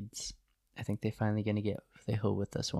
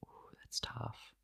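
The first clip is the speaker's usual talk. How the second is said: hushed whisper; 12 dB quieter, slower than usual. Real, not acted. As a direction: breathe it out in a whisper. Whispered reaction to tough fixture.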